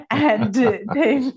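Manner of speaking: a real laugh breaking into the phrase; laughing through words. Real, not acted. Host laughing about disappointed patients, words breaking up in laughter. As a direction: let the laugh spill over the words.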